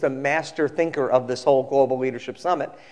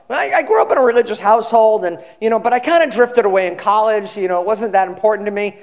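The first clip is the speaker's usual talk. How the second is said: higher-pitched imitation of a talkative passenger; chatty seatmate; 5 semitones higher, 4 dB louder than usual. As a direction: play an eager fellow traveler, pitched up. Acting chatty airplane seatmate's account.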